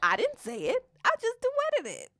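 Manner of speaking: high, loud self-defense; protesting. Real, not acted. Woman told the joke is wrong, protesting innocence. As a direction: pitch climbing in playful protest.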